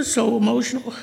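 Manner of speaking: ordinary delivery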